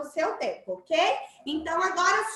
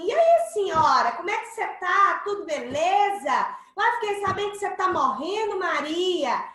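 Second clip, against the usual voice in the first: breezy, careless and louder; too casual; 4 semitones higher, 5 dB louder than usual. Shown as a demonstration. Interviewer greets a sick woman too casually, loud and breezy. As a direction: chat like a buddy to a dying woman. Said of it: "e falar assim".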